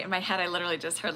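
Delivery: normal talking voice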